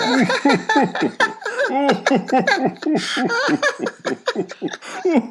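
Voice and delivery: loud high helpless laughter; peals of laughter. A narrator's reading. Grandpa Joe and Charlie burst out laughing at their bad luck. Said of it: "burst into peals of laughter".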